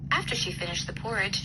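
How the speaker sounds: ordinary delivery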